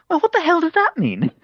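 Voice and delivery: very high mock posh princess, indignant. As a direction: squeal it like an outraged princess. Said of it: "in a really posh princess voice".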